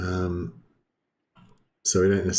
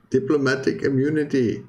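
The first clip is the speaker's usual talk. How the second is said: a hammed-up South African accent, pitched higher; 6 semitones higher than usual. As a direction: commit to the South African accent this time, playful and lifted. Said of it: "I can say some things in a bit of a South African accent".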